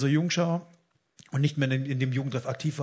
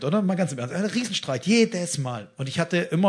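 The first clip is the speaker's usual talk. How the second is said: usual speaking voice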